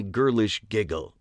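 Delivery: ordinary delivery, nothing marked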